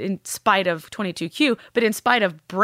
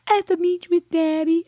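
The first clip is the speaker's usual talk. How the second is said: put-on higher child-like voice; imitating her daughter; 10 semitones higher than usual. Daughter naming her happy place, high child voice. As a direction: say it like the little girl would.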